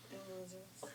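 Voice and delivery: whispering. Real, not acted. Whispered echo with laugh.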